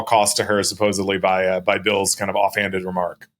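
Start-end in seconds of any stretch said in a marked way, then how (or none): none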